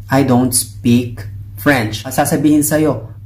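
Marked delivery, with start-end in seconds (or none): 0.1-2.1 slow, careful English; I don't speak French, each word slowly; slow translation. Shown as a demonstration. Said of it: "which is".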